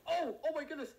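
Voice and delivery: high, loud shriek of alarm; panicked cry after being shot at; startled yell. Real, not acted. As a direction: yelp as the bullets fly. Suspect caught.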